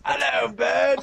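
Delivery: cheeky mock English accent. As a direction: play a comic English bloke. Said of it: "Say it in an English accent".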